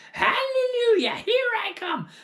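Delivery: shrieking high crone voice, crying out; crazed old woman triumph. Acted. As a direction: scream it like a witch taking flight. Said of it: "finding her voice at last".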